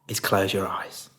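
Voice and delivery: soft, unhurried meditation voice with long pauses; guided meditation. Real, not acted. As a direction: guide them gently into stillness, leaving space between phrases.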